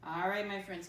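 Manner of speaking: ordinary delivery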